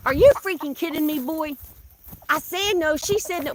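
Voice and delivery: high, loud scolding; exasperated scolding. Real, not acted. Telling off a rooster chasing a hen, loud and high. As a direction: yell at the rooster to quit.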